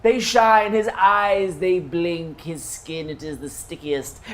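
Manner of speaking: sing-song fairy-tale verse; rhyming storybook narration. A narrator's reading. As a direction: recite it like a playful rhyme. GM narrating satyr in rhyme.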